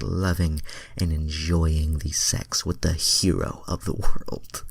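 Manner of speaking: ordinary delivery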